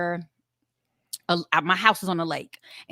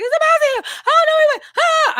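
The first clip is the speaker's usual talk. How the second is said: shrieking, much higher and louder, terrified; panicked scream; 8 dB louder than usual. Retold or imitated. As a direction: replay the moment you saw the mouse, screaming in pure panic. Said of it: "I'm screaming, I'm crying".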